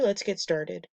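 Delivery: ordinary delivery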